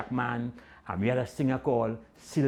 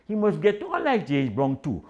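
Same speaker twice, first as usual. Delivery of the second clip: usual speaking voice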